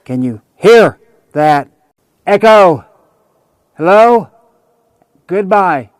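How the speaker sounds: calling out loud to test the echo; canyon echo. Shown as a demonstration. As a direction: shout slowly into the canyon, hello. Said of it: "and I'm echoing".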